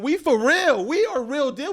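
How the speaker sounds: loud, high, insistent hype; fired-up boast. Real, not acted. Fired-up boast about his team.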